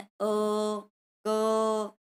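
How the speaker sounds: अ and क each held as a lone, drawn-out throat vowel or syllable; throat sounds demonstrated. Shown as a demonstration. Teacher has children feel throat sounds, demonstrated separately. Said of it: "आप बोल के भी दे सक".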